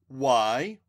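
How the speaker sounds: loud, sharp annoyed question. A narrator's reading. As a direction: snap it, annoyed.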